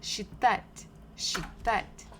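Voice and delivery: считать with the stress on its final а; stress on final syllable. Shown as a demonstration. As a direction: lean clearly on the а in считать. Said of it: "the stress falls on а".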